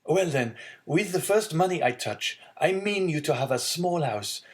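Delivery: bright, lightly voiced son; eager young man. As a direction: warm then worried son. Son speaking to his father.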